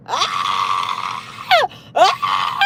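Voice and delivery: low mock scream of a hurt kid; imitated child scream. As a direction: act out the boy wailing on the ground. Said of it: "on the ground screaming".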